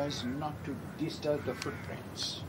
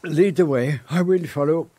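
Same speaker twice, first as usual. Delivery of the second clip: louder, urgent command; 8 dB louder than usual. Acted. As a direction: snap into action and lead out. Urgently heading to the body.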